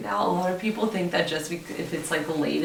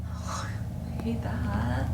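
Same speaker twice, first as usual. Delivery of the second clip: slow scared whisper; nervous night vlog whisper; 2 semitones higher, much slower than usual. Real, not acted. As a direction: whisper it, creeped out. Spooked after creepy message.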